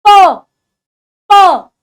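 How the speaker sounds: प said as a short, loud, unaspirated p; crisp p sound. Shown as a demonstration. Teacher showing the class a firm प. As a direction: pop the प with a firm burst. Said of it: "पतंगे या पानी वाला प".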